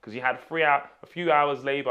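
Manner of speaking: loud, high-pitched demand for back pay; mock indignation. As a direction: rant at the old employer, half in jest. Mock demand to former employer for pay.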